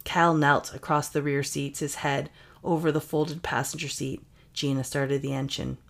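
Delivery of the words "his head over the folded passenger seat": plain reading voice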